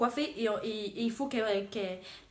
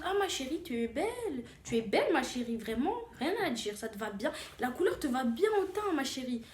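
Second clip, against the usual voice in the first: gushing salon clients; 2 semitones higher than usual. Retold or imitated. Other salon clients reassuring her, mock gushing. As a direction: imitate clients cooing compliments, swooping up and down. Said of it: "Et puis les autres clientes".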